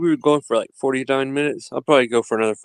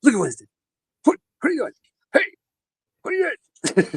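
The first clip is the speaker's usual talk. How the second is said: high-pitched calling to the dog; 11 semitones higher, much faster than usual. Real, not acted. Dad calls to his wild dog Winston in a squeaky high voice. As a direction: pitch shooting way up, teasing the pup.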